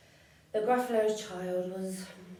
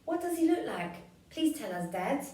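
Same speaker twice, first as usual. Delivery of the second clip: put-on child voice; 7 semitones higher than usual. Child asks father in story.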